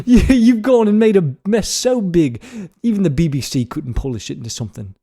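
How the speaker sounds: put-on wobbly British accent drifting toward Scottish or Irish; slipping British accent. Podcaster finishes the teleprompter read, British accent morphing Scottish. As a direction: keep up a British scolding as the accent slips. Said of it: "morphing into, like, Scottish".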